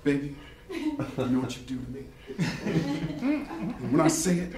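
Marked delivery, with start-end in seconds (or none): 0.0-2.0 low, soft, smooth radio-DJ croon; seventies DJ voice. Pretend. Seducing his imagined wife in a mock seventies DJ voice. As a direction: purr it like a late-night seventies DJ. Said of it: "I wanna sound like a seventies D J".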